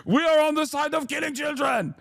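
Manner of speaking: high, quick mock-indignant accent; Israeli official. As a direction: proudly proclaim it in a hammed-up accent. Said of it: "they're constantly like".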